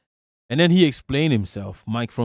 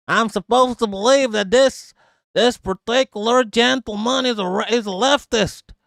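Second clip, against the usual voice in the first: mock sarcastic voice; 6 semitones higher than usual. Mocking the leftist claim.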